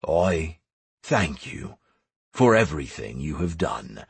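low, halting voice of an emperor; grave, grateful emperor. Acted. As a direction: give solemn thanks, pausing between phrases. New emperor solemnly thanks the hero.

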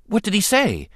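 put-on eager boy, high quick question; excited. A narrator's reading. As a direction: ask it like a thrilled kid.

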